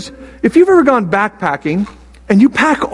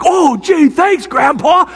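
put-on higher, sing-song grateful kids; mock grandkids; 7 semitones higher, much slower than usual. Grandpa imitating grandkids thanking him, mock grateful. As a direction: mimic the grandkids' gushing thanks. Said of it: "They thought I was like".

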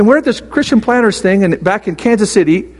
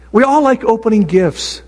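usual speaking voice

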